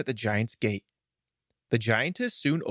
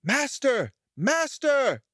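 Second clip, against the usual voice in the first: much higher magical harp crying for help; enchanted harp alarmed as it is stolen; harp calling out; 13 semitones higher, slower than usual. A narrator's reading. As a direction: call urgently to the sleeping master. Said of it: "the harp called out".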